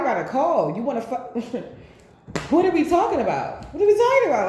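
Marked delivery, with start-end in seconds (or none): 2.5-4.5 high, loud sassy auntie shout; rising into a big indignant auntie voice; Medea voice. Retold or imitated. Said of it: "Like my Medea voice".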